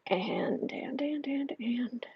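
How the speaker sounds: breathy whispered hesitation; whispering. Real, not acted. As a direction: whisper while thinking.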